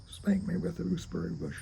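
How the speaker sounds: ordinary delivery, nothing marked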